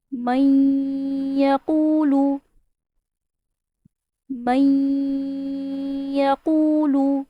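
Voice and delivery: مئی with nasal hold, یقولو drawn out slowly; slow model reading. Shown as a demonstration. Teacher slowly modelling the correct recitation. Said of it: "کیونکہ با غنہ ہے۔".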